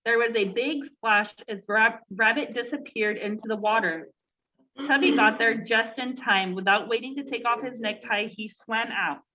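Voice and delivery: usual speaking voice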